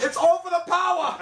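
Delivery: very high battle-cry parody; mock heroic scream. Screaming at Power of Grayskull, high mock battle cry. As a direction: cry it out high like a cartoon hero. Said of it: "as you scream at Power of Grayskull".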